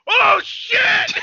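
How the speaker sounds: high, loud, stretched-out yell; reliving a shocked shout. Retold or imitated. Telling his friend how he reacted, reliving the shout. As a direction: scream it like the hit just landed. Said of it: "and I was like".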